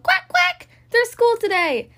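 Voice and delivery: high squeaky duckling calling out; high little duck voice. Acted. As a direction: chirp it like a tiny excited duck. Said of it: "Little duck called".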